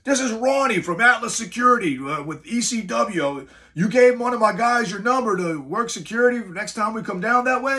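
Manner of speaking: high, loud New York Bronx caller voice; heavy Bronx accent. Retold or imitated. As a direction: imitate a brash Bronx security boss on the phone. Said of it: "Very heavy New York Bronx accent".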